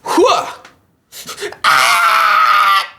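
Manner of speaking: high yelp, then a loud cry; mock startled scream. Victim on the phone, startled, mock scream. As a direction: fake a panicked shriek into the phone. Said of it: "You just like".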